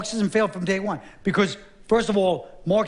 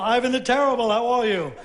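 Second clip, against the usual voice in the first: لنبرة تحية مرحة مبالغ فيها; cheery greeting; 5 semitones higher than usual. Retold or imitated. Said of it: "people come up to Ivan the Terrible".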